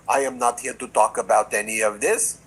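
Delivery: a hint of Melania's Slovenian accent, cool and flat denial. Host mimics Melania dodging questions, faint Slovenian accent. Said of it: "I'm not sure I do the accent".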